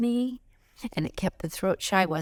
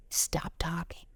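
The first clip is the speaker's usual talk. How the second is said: whispered advice; 7 dB quieter than usual. Acted.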